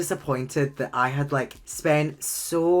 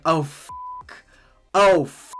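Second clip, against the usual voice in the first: flustered realization; 2 semitones higher, 6 dB louder than usual. Retold or imitated. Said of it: "I was like".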